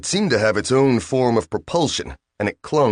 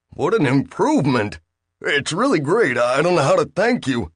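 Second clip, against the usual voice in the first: eager Bill; 6 semitones higher than usual. Acted. Bill gushing thanks to machine.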